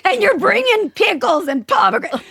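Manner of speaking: high-pitched retold tantrum cry; losing it. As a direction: re-enact a mom at breaking point, voice way up. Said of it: "That was bad".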